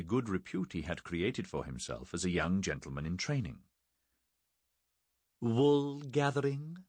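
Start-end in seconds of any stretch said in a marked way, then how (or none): 5.4-6.8 slow, haughty high-pitched question; lofty sniff. A narrator's reading. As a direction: ask it down your nose, slowly. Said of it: "lofty sniff".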